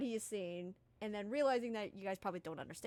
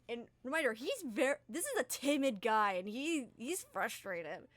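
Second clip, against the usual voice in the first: ordinary delivery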